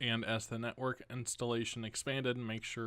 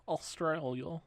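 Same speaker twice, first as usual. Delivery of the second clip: a weak stab at an Australian accent on Australia, rising at the end; failed Australian accent; 5 semitones higher than usual. Shown as a demonstration. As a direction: try an Australian accent and miss. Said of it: "That was not an Australian accent".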